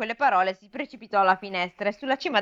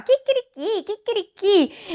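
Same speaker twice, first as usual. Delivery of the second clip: crowing rooster, much higher; canto del gallo; 13 semitones higher, slower than usual. A narrator's reading. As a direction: crow it out high like a rooster. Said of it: "e cantava". (chicchirichì acuto da gallo)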